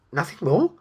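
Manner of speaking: high-pitched, disbelieving question; incredulous. A narrator's reading. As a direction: ask as if you can't believe that is all. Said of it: "it asked incredulously".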